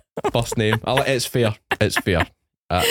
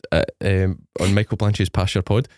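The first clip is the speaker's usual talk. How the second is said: normal talking voice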